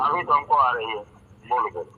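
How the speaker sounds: radio breaking up. Real, not acted. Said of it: "आवाज़ साफ़ नहीं आ रही आपकी".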